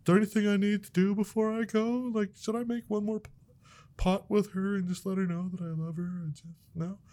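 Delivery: imagined dead man calmly accepting his fate; mock resigned shrug. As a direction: shrug it off like it's nothing. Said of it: "why do I have to go".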